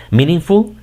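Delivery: "Meaningful" high and loud; English word. Shown as a demonstration. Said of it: "Fíjate cómo se escucha". ("Meaningful" en inglés, agudo)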